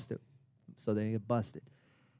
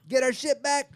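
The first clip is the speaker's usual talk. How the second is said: high, louder triumphant call; gloating cheer; 12 semitones higher, 5 dB louder than usual. Retold or imitated. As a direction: jeer it as retold celebration. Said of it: "laughing our asses off".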